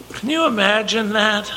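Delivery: wondering, hushed. Real, not acted. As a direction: let the question hang in awe. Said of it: "repeats question".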